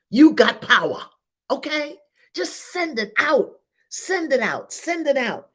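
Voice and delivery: high, ringing call to send it out; fervent exhortation. Real, not acted. Preacher urging listeners to send their power out, high and ringing. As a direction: lift them up with a charged cry.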